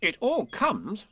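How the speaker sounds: high, slow, reproving; prim rabbit's telling-off; stern. A narrator's reading. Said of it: "said Rabbit sternly".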